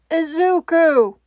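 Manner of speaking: loud, slow cry of his name; anguished cry. Acted. All Might calling out to Izuku as the line cuts. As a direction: call out to stop him.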